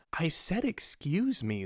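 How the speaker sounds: high, soft, drawn-out mock complaint; put-out lady customer. Lady repeats herself to the seller, mock put-out. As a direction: mimic her with slow, swooping emphasis.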